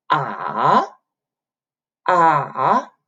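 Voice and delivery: ah dips low then rises; dipping tone. Shown as a demonstration. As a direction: drop ah low then bring it up. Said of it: "you go down a little bit and then go up".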